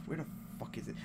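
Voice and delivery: under-breath frustrated curse; muttered curse. Real, not acted. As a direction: mutter it to yourself. Muttering while searching the site.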